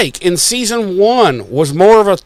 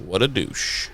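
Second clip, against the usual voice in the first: much lower, quick and unbothered; cool dismissive Wood; 6 semitones lower, 12 dB quieter than usual. Retold or imitated. Principal Wood dismissing the First, low and cool. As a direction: drop low and brush it off fast.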